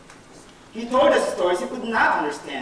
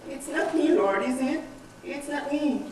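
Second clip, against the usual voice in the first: higher, softer anxious protest; mock scared disciples; 3 semitones higher, 7 dB quieter than usual. Disciples at the table deny betrayal, mock scared. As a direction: imitate frightened followers pleading innocence. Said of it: "talking like a flock of scared turkeys".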